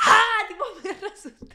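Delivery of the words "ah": loud, high re-enacted fright scream. Re-enacting her terror at the old woman, high scream. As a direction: scream as if badly startled. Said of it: "tipo, empecé a gritar".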